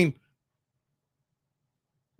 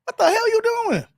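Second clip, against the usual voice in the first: much higher, cracked disbelief; high-pitched what-the-hell reaction; mock incredulous; 13 semitones higher than usual. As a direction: squeak out shocked outrage. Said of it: "Be like".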